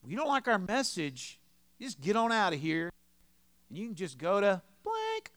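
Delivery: loud, high dismissive send-off; mock a hostile church telling someone off; ugly churchgoer. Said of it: "this is where churches can get really ugly".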